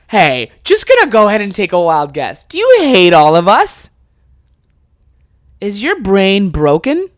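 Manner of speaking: loud, hammed-up accusing questions; incredulous confrontation. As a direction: confront her in loud, stretched-out disbelief. Acted confrontation with stepmom in shed.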